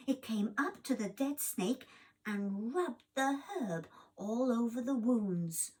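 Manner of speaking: ordinary delivery, nothing marked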